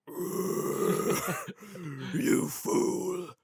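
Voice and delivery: whispered, menacing villain. Pretend. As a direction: taunt like a dark overlord. Villain voice of the cough.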